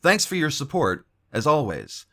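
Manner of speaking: big promo voice wrapping up the video; announcer outro. Acted. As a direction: sell the channel like a radio host. Loud announcer outro for the channel.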